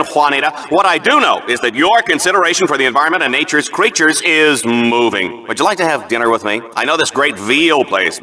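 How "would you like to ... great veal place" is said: sleazy flirting. Acted. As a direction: slip in the dinner invite fast and low. Announcer hits on co-host.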